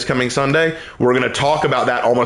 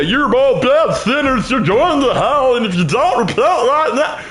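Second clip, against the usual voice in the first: mock fire-and-brimstone preacher; 11 semitones higher than usual. Pastor mocking a preacher who condemns his listeners as sinners. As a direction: sneer it like a condemning street preacher. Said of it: "I will never come at you and say".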